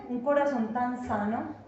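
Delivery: ordinary delivery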